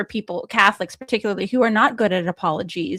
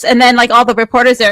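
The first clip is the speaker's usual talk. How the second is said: usual speaking voice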